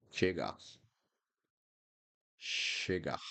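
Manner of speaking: Chegar with ch as sh; Portuguese ch. Shown as a demonstration. Teacher models Chegar, ch said as sh. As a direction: begin Chegar with sh. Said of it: "that's the sound of C H".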